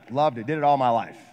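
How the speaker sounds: ordinary delivery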